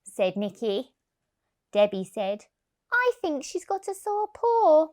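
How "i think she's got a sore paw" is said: put-on child's voice.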